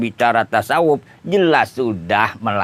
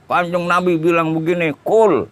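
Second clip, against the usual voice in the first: higher, declaiming preacher imitation; 5 semitones higher than usual. Shown as a demonstration. Preacher quoting the Prophet with wrong intonation, imitated declaiming. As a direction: mimic a preacher, punching the last word higher. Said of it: "Katakan orang ceramah".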